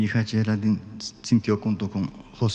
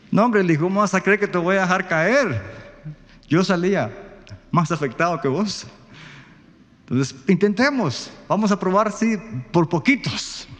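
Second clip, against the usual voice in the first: reassuring father; 7 semitones higher than usual. Retold or imitated. Father reassuring daughter, voiced quote.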